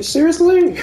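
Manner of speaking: high, loud incredulous "Seriously?"; mock disbelief. Opponent can't believe it, high and loud. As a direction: sell the disbelief. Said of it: "He's like".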